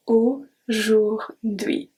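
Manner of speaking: Aujourd'hui drawn out very slowly, ending clearly in oui; slow model word. Shown as a demonstration. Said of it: "U I make the sound oui".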